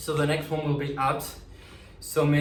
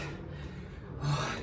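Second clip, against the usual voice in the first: tired sigh; 8 dB quieter than usual. Real, not acted. Tired after the workout.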